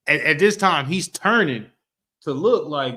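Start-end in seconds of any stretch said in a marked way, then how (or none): none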